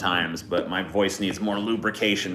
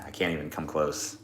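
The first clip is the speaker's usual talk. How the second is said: normal talking voice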